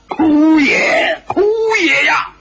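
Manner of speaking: high, loud wail of despair; anguished lament. A narrator's reading. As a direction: wail it out as if beating your chest. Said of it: "白自在捶胸顿足". (捶胸顿足地高声哀号)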